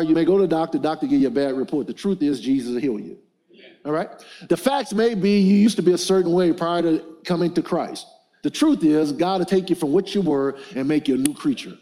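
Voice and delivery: ordinary talk, nothing marked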